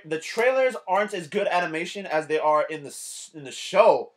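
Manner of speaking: ordinary talk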